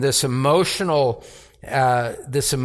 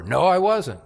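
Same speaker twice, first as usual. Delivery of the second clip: firmer, higher denial with weight on I; insistent denial; 6 semitones higher than usual. Retold or imitated. Peter denying again under pressure, firmer insistent voice. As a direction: push back harder on I.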